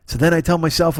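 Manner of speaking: usual speaking voice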